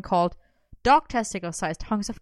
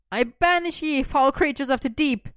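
mock banishing; 6 semitones higher, 3 dB louder than usual. A narrator's reading. Merlin fending off with spatula.

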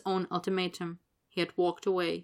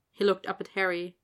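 ordinary delivery

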